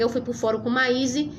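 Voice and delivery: ordinary talk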